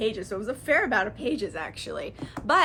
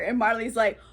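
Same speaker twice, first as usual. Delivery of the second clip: usual speaking voice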